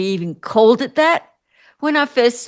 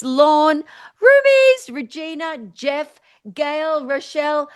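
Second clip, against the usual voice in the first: higher, drawn-out greeting by name; roll call; 5 semitones higher than usual. Real, not acted. Host greeting online viewers one by one, higher roll call. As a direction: call each name out high and lively.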